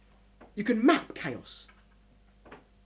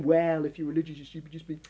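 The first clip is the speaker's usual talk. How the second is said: mock Marxist; 8 semitones lower, 8 dB quieter, faster than usual. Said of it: "This isn't a Karl Marxian".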